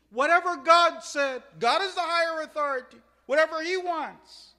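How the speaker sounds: loud, high, forceful proclamation; emphatic declaration. Acted. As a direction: proclaim it at full voice.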